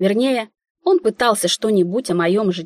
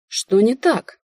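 mildly wary question, pitched up; a touch of sudden wariness; 4 semitones higher, slower than usual. A narrator's reading. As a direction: ask it with guarded suspicion. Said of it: "насторожилась я".

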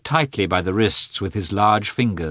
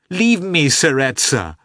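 loud, high cried plea; spoken plea; 9 semitones higher, 6 dB louder than usual. A narrator's reading. Wife crying out to her husband. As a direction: cry it out openly.

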